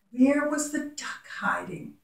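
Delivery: put-on whispered question to the children; hushed teacher question. Shown as a demonstration. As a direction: whisper it like a secret game. Teacher asking children to recall.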